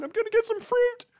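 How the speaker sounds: very high, hammed-up heroic resolve; mock heroic shopper. Shopper in a Superman cape, hammed-up bravado. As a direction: announce it like a superhero mission.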